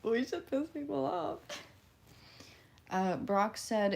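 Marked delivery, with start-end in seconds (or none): 0.0-1.4 higher, impish put-on voice; mischievous. Streamer recalling deciding to annoy people, impish higher voice. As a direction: sound like you're plotting mischief. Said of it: "and I was like".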